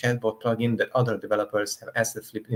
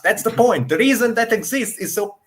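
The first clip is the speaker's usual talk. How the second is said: loud, high, insistent rebuttal; heated outburst; 9 semitones higher, 6 dB louder than usual. Real, not acted. Defending asset use to friends, loud and heated. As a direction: throw the objection back, fired up.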